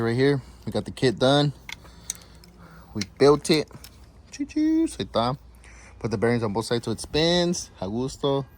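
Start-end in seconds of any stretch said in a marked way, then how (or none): 4.3-4.9 playful choo-choo train noise. Pretend.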